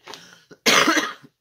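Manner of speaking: loud, sudden coughing fit; choking cough. Real, not acted. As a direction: cough hard as if choking on spit. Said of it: "I choked on my own spit".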